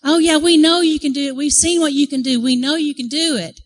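high excited crowd voice; imitated eager hollering. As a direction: egg him on like a roused audience. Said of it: "they were whistling and screaming and hollering".

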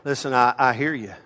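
low, softened voice of a listener; calming. Retold or imitated. Preacher to an angry person who came for counsel, calming. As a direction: talk an angry person down quietly. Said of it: "I would say things like this".

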